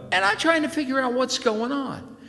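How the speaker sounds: ordinary talk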